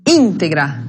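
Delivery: "Íntegra" stressed on ÍN, falling away after; teacher's demonstration of "Íntegra", first syllable stressed. Shown as a demonstration. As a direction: hit the opening of "Íntegra". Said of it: "tem acento gráfico".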